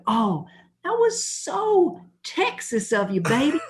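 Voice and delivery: high, drawn-out parody of delight; mock gushing. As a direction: mockingly coo at your wife, high and slow. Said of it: "I'm like".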